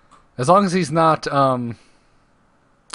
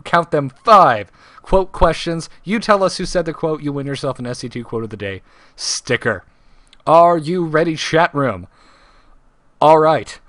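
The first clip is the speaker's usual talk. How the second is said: raised, punched-up trivia announcer; show announcer; 3 semitones higher, 3 dB louder than usual. Acted. Host kicks off quote trivia for live audience, announcer style. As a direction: hype up the live audience for the quiz.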